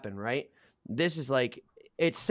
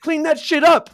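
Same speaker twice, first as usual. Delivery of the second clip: much higher and louder, a barked order; retold yell; 12 semitones higher, 10 dB louder than usual. Hallmate yelling at Big Country to clean up, loud. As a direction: bark it down the hall like an enforcer. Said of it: "yells at him".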